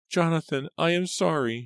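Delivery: put-on very high, frightened little-girl cry; Rachel, shrill with fear. A narrator's reading. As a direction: cry out an apology as a scared child. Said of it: "she cried, her voice shrill with fear".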